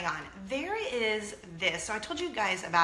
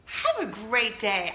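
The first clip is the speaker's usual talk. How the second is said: laughing imitation; 5 dB louder, slower than usual. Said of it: "And she's like".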